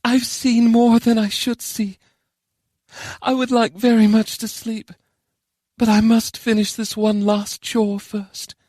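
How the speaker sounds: high, slow character voice; high-pitched, tired and resolute; weary Charon. Acted. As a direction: speak high and slow, longing for rest.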